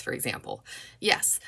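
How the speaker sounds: usual speaking voice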